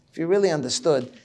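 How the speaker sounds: normal talking voice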